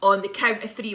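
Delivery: usual speaking voice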